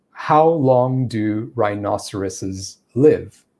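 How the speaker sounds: slow, measured question with gaps between words; very slow cadence. Shown as a demonstration. Teacher illustrating cadence in a sentence, slow. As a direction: space out each word deliberately. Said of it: "I'm using a very slow cadence there".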